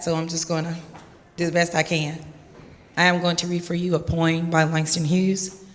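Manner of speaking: usual speaking voice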